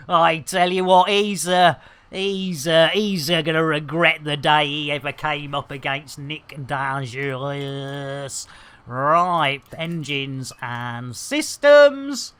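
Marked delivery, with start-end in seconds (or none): none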